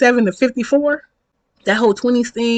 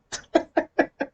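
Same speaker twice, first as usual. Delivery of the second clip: soft, high, stuttering laughter; real giggling fit; helpless laugh; 9 semitones higher, 6 dB quieter than usual. Real, not acted. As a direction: keep cracking up, higher and quieter. Laughs at own story.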